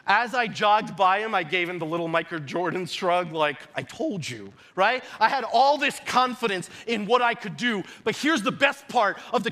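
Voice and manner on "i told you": low, soft smug aside; cocky. Retold or imitated. Player jogging past the beaten coach, retold cockiness. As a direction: toss it off with a shrug, low and quiet. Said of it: "my cockiness got the best of me".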